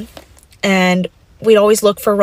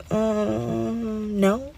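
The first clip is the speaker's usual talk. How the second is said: quiet; 10 dB quieter than usual. Retold or imitated. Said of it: "And I was like".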